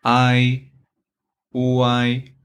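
"I, U, I" as level Mandarin finals ai, uai; Chinese pronunciation. Shown as a demonstration. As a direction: model "I" as ai and "U, I" as one gliding uai. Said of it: "In Chinese".

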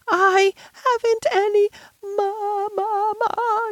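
put-on high, drawn-out childish wail; half-hearted howl. A narrator's reading. Lottie starting to bawl again, half-hearted howl. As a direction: wail slowly on a high held pitch, not too strong. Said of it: "first howl rather half-hearted".

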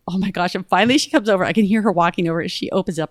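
ordinary delivery

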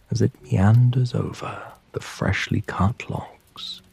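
plain reading voice

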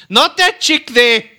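loud, slow pointed jab. Acted. Preacher warns husbands, loud and slow. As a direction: hit it hard and slow like a warning. Preacher jabs at wandering eyes.

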